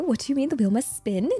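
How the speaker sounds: ordinary talk, nothing marked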